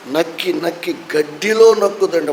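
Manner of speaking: normal talking voice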